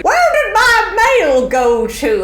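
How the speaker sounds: put-on high, slow, creaky old lady. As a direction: play a doddery granny fretting over her mail. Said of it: "I can do a very good old lady".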